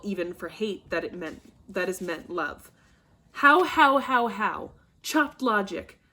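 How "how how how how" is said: angry outburst. Acted. Capulet enraged at daughter's answer.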